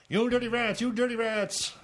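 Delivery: high, mocking gangster voice. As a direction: squeal it like an old movie gangster. Character voice in A-Team reading.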